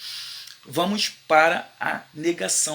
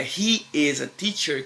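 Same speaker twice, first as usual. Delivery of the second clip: slow statement model; slower than usual. Shown as a demonstration. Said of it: "que é uma afirmação".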